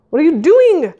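high-pitched startled question; startled yelp. A narrator's reading. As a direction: yelp it, caught off guard. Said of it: "Peril yelped".

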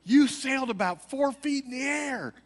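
high, awed woman's voice; amazed lady. Retold or imitated. Lady behind them telling him he flew, high and awed. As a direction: marvel at what she saw. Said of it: "the lady in back goes".

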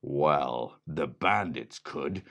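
ordinary delivery

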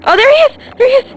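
high, loud excited shout; thrilled to spot it. Real, not acted. Spots the lizard.

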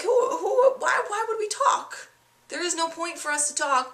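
spiral up into panicked disbelief; stunned disbelief. Retold or imitated. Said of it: "And I was like".

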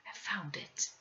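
hushed whisper of a grim discovery; quiet. A narrator's reading. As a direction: breathe the words out, barely voiced. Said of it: "said quietly".

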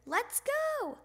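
high, drawn-out summons; father hollering from another room; calling out. A narrator's reading. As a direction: call it out high to hurry him along. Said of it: "called his father".

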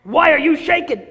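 loud, high wolf bellow; loud wolf demand. Acted. Wolf bellowing at the trembling girl. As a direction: bellow it at her.

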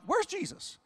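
high urgent question; high retold where's Jesus; retold demand. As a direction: ask it searching and urgent. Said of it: "And I'm like".

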